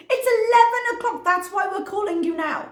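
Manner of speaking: loud, very high retort; retold outraged protest; exasperated. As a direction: burst out high and indignant. Continues exasperated retort to helpline.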